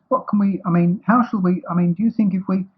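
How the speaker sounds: high, faltering restarts; distressed. A narrator's reading. Pooh trying to help Eeyore, flustered distress. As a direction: fumble anxiously for the question. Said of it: "said Pooh in distress".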